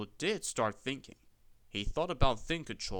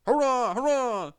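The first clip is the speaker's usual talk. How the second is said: loud, high triumphant roar; roaring cheer; 11 semitones higher, 9 dB louder than usual. A narrator's reading. Trucks roaring as they hurtle downhill, loud. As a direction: roar it out in wild glee. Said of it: "they roared".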